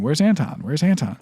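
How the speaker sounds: loud, panicked calling out for a missing son; frantic shout. Retold or imitated. Mother shouting for her missing son, frantic loud call. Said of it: "She kept shouting".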